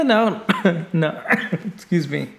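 coughing through the words; choked coughs between words; choking. Real, not acted. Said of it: "I'm choking here".

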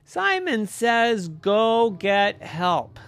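put-on emphatic, measured child's command; deliberate command. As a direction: spell out the order word by word. Boy gives deliberate game command.

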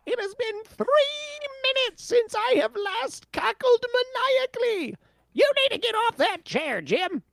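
very high squeaky maniac; mad scientist. Acted. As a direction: shriek like a tiny madman. Voicing mad Yordle.

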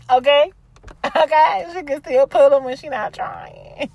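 high, loud, giggly boast; playful bragging. Real, not acted. Host boasting she still got it, playful bragging. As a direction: crow about yourself, laughter bubbling round it.

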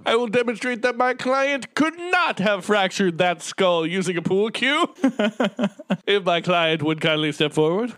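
grand lawyer addressing the jury, fast; courtroom lawyer. Retold or imitated. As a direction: play the pompous expert witness lawyer. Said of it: "lawyer addressing the jury".

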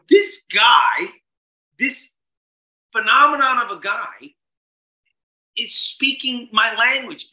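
high, loud awestruck outburst; marveling at the speaker on stage; amazed inner shout. Retold or imitated. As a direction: blurt it out in wonder. Inner thought, amazed by Tony.

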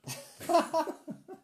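loud squealing laugh; high burst of laughter. Real, not acted. Laughing at Babe Station joke.

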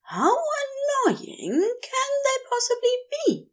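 high, sing-song mimicry of his lover's words; mock quoting Crowley. A narrator's reading. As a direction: throw his own words back at him, mocking. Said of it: "you said".

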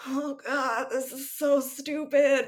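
high-pitched groan of disbelief; upset exasperation. Real, not acted. Podcaster reacting to the couple's silly fight, upset exasperation. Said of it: "that's how upset I am about this".

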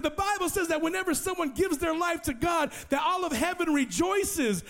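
high, exultant retold explanation; excited explaining. Evangelist explains rejoicing to his new convert, excited. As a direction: proclaim the good news with a lifted voice.

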